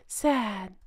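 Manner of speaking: soft, quiet, droopy mock-sad sigh of a word. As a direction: sell the fake sorrow, softly. Mocking her staged sadness.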